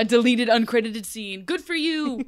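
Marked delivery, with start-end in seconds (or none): 1.4-2.3 squeaky proud voice; high-pitched praise. Real, not acted. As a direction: chirp it out like a proud fan.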